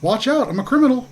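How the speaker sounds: Glub's warning. Acted. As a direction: draw it out in a high, simple voice. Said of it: "he goes".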